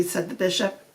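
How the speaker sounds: usual speaking voice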